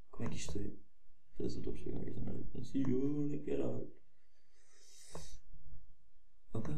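real irritation, muttered swearing; frustrated muttering. Real, not acted. Frustrated with online tool.

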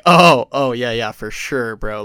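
loud sudden "oh, oh" then slow easy agreement; mock relieved surprise. Guards relieved after hearing about the trip, mock surprise.

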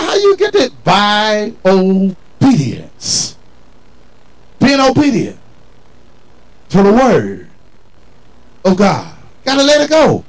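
usual speaking voice

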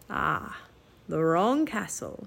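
low, soft sinister musing; lightly voiced silky menace; raven king voice. A narrator's reading.

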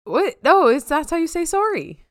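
high, loud, playful objection; animated protest. Real, not acted. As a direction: insist loudly, pitched right up. Mock protest over Canadian pronunciation.

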